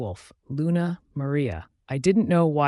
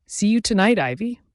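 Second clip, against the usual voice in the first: higher and louder on "See you tonight"; stressed words; 7 semitones higher, 5 dB louder than usual. A narrator's reading. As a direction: lean hard on "See you tonight" with a smirk. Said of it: "Antonio stressed the words".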